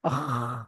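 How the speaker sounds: low scraping noise from the throat; drawn-out throaty "kh" noise; throat-clearing rasp. Shown as a demonstration. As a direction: demonstrate hawking from inside the throat. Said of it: "গলার ভেতর থেকে একটা আওয়াজ".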